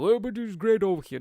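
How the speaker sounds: mock defensive local. Resident defending a tornado town, mock protesting. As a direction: insist brightly in a hammed-up voice.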